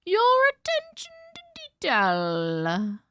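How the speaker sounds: high, slow, sing-song your attention to detail; hammed-up high sing-song compliment; mock posh praise. Shown as a demonstration. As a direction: drawl it out with exaggerated high praise. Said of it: "I appreciate your attention to detail".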